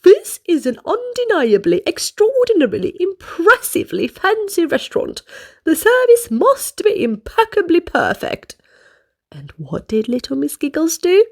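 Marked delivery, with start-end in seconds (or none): 0.0-8.5 high, pompous manager voice; lightly voiced self-important boss. Manager lectures the new waitress importantly. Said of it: "in a very important voice".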